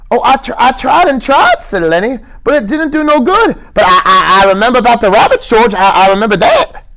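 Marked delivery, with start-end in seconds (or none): 0.1-1.6 put-on high stammering childlike plea; Lenny, earnest. Lenny's held voice. 2.4-6.8 put-on high stammering childlike plea; Lenny, earnest. Lenny's held voice.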